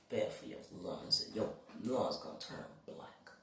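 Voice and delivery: whispering. Real, not acted. Warning chat about smoking.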